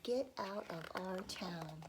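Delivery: ordinary delivery, nothing marked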